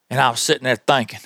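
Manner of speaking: ordinary delivery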